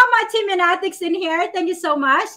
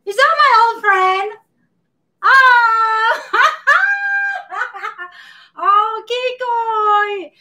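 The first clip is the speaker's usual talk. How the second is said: excited greeting; 7 semitones higher, 3 dB louder, much slower than usual. Real, not acted. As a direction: burst out happily on recognising him. Spots old friend joining stream.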